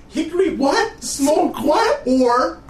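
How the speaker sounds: high, excited quote voice; Doctor Tran voice. Retold or imitated. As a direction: squeak it like the cartoon. Quoting Doctor Tran on t-shirt.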